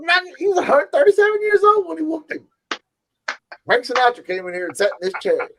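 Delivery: put-on very high, fast old man bragging; old barber impression. Friend as the old barber bragging about famous visitors, very high. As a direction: crank it way up and rattle the brag off.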